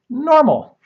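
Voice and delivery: normal, jumping way up and loud; stress on normal. Real, not acted. Telling friends the tests came back, normal punched high. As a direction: land normal as an incredulous punchline.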